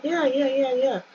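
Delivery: a hint of a boyfriend rushing to agree; eager boyfriend. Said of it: "And he's like".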